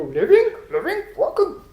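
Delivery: high-pitched, incredulous mock German; baffled German worker. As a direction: puzzle over the word, then answer high and firm. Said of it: "They go".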